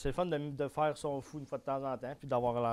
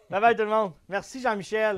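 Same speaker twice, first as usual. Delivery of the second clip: loud cheerful goodbye; upbeat sign-off; 6 semitones higher, 9 dB louder than usual. Acted. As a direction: wave the audience off brightly.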